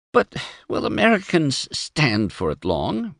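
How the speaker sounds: small, fearful whimpering question; a frightened wife, barely daring to ask; whimpered. A narrator's reading. Emma fearfully asking her husband, whimpering. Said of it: "whimpered Emma".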